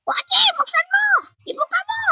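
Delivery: very high, squeezed squeal; pinched squeaky voice. Pretend. Host telling Boji to open up, pinched squeaky voice. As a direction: order it in a tiny squeezed voice. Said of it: "Ipit na rin yung boses ko".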